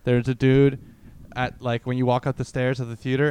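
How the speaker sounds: usual speaking voice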